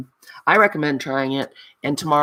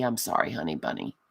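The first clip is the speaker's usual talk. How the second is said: ordinary talk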